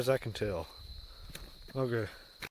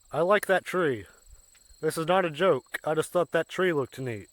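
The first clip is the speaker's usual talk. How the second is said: ordinary talk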